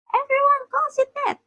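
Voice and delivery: very high, insistent retort; shouting back. A narrator's reading. Luffy insisting everyone calls it that, high-pitched shouting back. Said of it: "Luffy shouted back, quoting".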